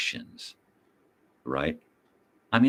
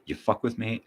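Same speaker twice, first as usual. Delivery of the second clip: ordinary talk, nothing marked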